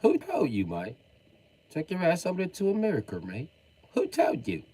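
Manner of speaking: high-pitched put-on British accent; high, hammed British protest; mock British indignation. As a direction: squawk it as an indignant Brit. Acted British indignation at colonists.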